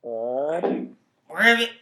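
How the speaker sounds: loud croaked ribbit; frog noise. Pretend. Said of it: "What's your best frog noise?".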